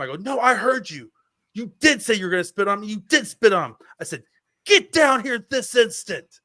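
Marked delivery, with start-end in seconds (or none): 0.2-3.8 high, insistent angry accusation; heated, raised-voice scolding; angry retort. Retold or imitated. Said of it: "in a very angry tone". 4.6-6.4 high, loud, slow command; yelled, drawn-out scolding; angry order. Retold or imitated. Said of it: "in a very angry tone".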